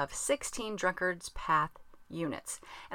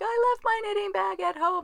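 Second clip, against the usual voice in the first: much higher, whining mock wail of "I left my knitting bag at home"; whiny text voice; 14 semitones higher, 6 dB louder than usual. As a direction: wail it high like a sulking child. Quoting her own whiny text.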